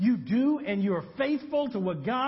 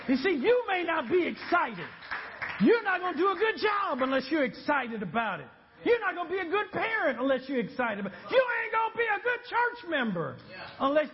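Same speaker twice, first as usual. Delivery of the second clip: full-force preaching; 6 semitones higher than usual. Acted. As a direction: build it up and shout it to the congregation.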